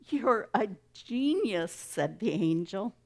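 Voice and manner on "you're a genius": high-pitched, admiring exclamation; amazed praise, voice lifted; marvelling. A narrator's reading. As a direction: exclaim it in delighted awe. Angel marvels at the Creator.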